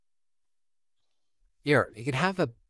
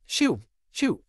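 shooing shout; 6 semitones higher, 4 dB louder than usual. Acted. Broom against the kid.

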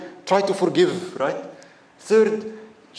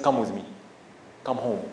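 lower, softer kindly invite; gentle invitation; 6 semitones lower, 6 dB quieter than usual. Retold or imitated. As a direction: coax him home gently. Prophet gently invites the Bedouin.

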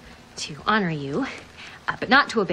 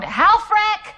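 shouting a name; 6 semitones higher, 6 dB louder than usual. Acted. Calling out to vengeance demon.

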